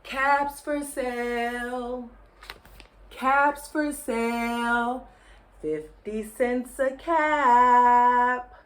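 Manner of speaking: slow street-vendor call; peddler calling wares. Acted. Peddler calls his wares walking back to town, slowly. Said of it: "he walked back to town calling".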